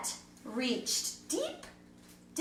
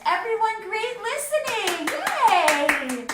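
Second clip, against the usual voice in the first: excited cheer; 9 semitones higher, 5 dB louder than usual. Real, not acted. Cheering kids after story.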